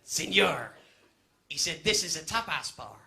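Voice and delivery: put-on low, deadpan Spanish accent; Spanish barman voice. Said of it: "he went".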